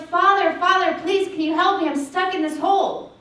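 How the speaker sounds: high, loud plea for help; yelling for help. Retold or imitated. Boy stuck in a hole yells up to the minister, loud and pleading. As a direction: shout up from the hole, begging to be rescued. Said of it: "and the boy yells".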